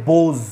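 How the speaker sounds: বোজ with a long O and buzzed final Z; pronunciation demo. Shown as a demonstration. Teacher showing how the plural sounds, বোজ with buzzed z. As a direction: model বোজ clearly, holding the closing z. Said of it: "উচ্চারণ হয়ে যাবে".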